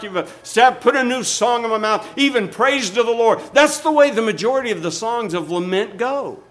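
high, loud, driving sermon delivery; full-force preaching. Real, not acted. Pastor telling how psalms of lament end, full-force preaching.

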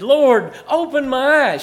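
crying out to the Lord, high and loud; loud retold prayer; fervent prayer. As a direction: call out the prayer like a plea from the pulpit. Retold prayer for her eyes.

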